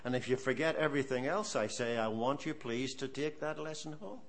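ordinary talk, nothing marked

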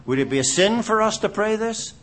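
normal talking voice

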